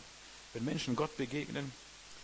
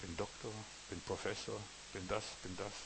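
mock boasting; 3 semitones lower, 10 dB quieter than usual. Preacher mocking a proud man, hushed mock boast. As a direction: murmur the titles like a smug show-off. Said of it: "Ich kann sagen".

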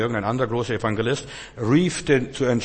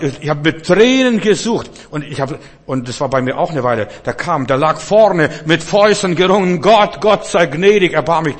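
impassioned preaching; 6 semitones higher, 9 dB louder than usual. Acted. Preacher voicing the man who begged God, loud and high. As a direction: cry out the man's desperate plea.

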